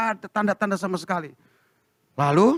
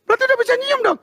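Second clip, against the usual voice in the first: much higher, delighted question; excited realisation; 3 dB louder, faster than usual. Retold or imitated. Husband realising his wife's smell is back, excited high. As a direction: burst out with the happy discovery.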